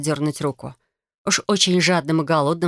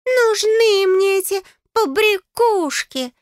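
high, slow, scornful squeak; drawn-out piping disdain; Gustav, disgusted; 4 dB louder, much slower than usual. A narrator's reading. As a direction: sneer in a tiny fairy voice. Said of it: "брезгливо поморщился Густав".

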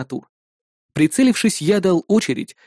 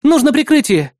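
high, louder plea for backup; urgent call; 10 semitones higher, 5 dB louder than usual. Acted. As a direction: call out for cover under fire. Woman fighter calls for cover.

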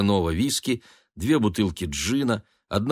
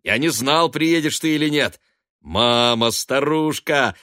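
raised, hearty voice; boisterous greeting; 6 semitones higher, 5 dB louder than usual. A narrator's reading. Son hugging his mother, boisterous. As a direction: greet them high and loud.

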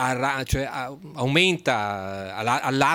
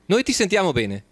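raised repeat; 6 semitones higher than usual. Real, not acted. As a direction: say it again clearly, raised for a bad line.

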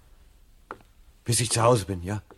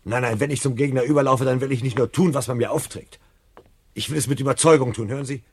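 insistent; 5 semitones higher, 8 dB louder than usual. Acted. Montag insists he wants conviction.

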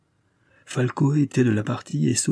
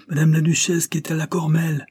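shouted announcement; 7 semitones higher than usual. Acted. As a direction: call out breathlessly that the duchess has come. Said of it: "cria-t-il".

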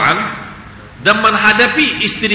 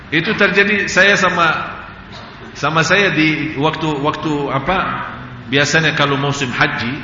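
usual speaking voice

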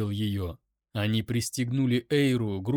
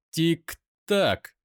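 higher, cooing mock countdown; cooing taunt; 6 semitones higher, 4 dB louder than usual. A narrator's reading. Kai taunts his captive as time runs out, cooing. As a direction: coo it as a taunt. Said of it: "проворковал Кай".

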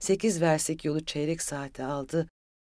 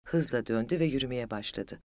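usual speaking voice

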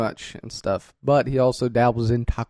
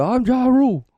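high rapper self-intro; 10 semitones higher, 3 dB louder than usual. Retold or imitated. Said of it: "songs start off with".

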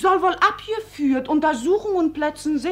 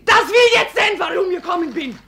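high, loud shout; shouted demand; 4 semitones higher, 5 dB louder, faster than usual. Acted. Maid shouting that she wants to see her child. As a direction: yell the demand.

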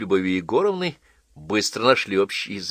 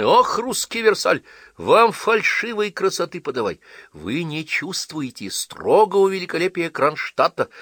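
high, loud indignant scolding; 10 semitones higher, 4 dB louder than usual. A narrator's reading. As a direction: scold them with mock outrage. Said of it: "с возмущением говорила Крупская".

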